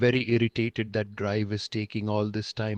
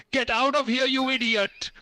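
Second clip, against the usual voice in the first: much higher, louder hammed-up yell; furious yell; 3 dB louder than usual. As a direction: throw him out with a shout. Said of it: "You have to whip him".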